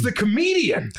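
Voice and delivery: high, slow, delighted recognition; high-pitched stranger. Retold or imitated. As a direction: point him out gleefully. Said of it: "they're like".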